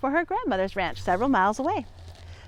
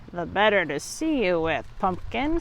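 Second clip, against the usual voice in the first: lower, softer sly wolf; 4 semitones lower, 4 dB quieter than usual. Acted. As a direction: purr it like a hiding wolf. Wolf in grandma's bed.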